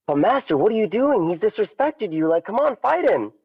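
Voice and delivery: high, pleading students' voices; pleading students. Retold or imitated. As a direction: beg the master to fight back. Students urge master to fight.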